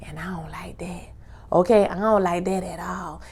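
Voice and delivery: hushed whisper. Real, not acted. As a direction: whisper it like a secret complaint. Aside about phone ads.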